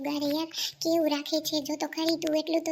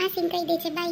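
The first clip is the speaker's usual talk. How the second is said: ordinary talk, nothing marked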